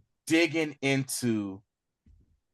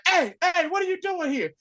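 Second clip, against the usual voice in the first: challenging call. Retold or imitated. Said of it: "driver was like".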